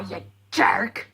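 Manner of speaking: hissed under the breath; whispered insult. Retold or imitated. Fan calling Hawkmoth a jerk, whispered. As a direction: drop to a scornful whisper. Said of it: "I'm sitting there at least going".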